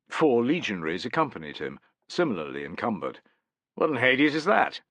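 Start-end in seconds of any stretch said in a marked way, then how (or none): 3.8-4.8 demanding. Acted. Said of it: "demanded Tullus".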